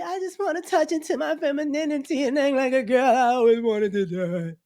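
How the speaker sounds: high mocking voice of a man finding his feminine side; mock grown man. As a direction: lift the voice high to mock him. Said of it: "you finally".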